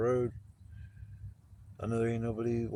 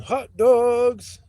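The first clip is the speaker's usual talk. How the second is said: loud, high hot dog hawker's call; yelling vendor; 11 semitones higher, 10 dB louder, much slower than usual. Retold or imitated. Hot dog seller calling out to the arena crowd, yelling. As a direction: holler it out slowly, stretching the words like a stadium vendor. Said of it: "This guy's yelling".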